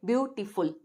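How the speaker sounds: "ब्यूटीफुल" sounded out as "byu, ti, ful"; carefully divided "ब्यूटीफुल"; three syllables. Shown as a demonstration. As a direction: give "ब्यूटीफुल" three distinct beats. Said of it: "तीन हिस्सों में अदा होगा".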